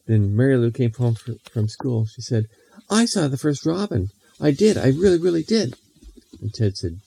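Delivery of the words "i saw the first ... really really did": put-on little girl character voice.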